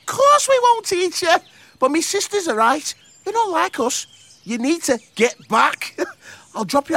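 high, cheeky bug voice; proud, bouncy little beetle; chirpy beetle voice. Acted.

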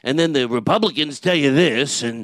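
loud ranting Carlin, slowing at the end; George Carlin impression. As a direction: rant hunched over like Carlin. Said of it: "but I can go".

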